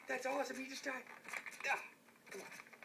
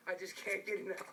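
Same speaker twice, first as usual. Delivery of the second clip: normal talking voice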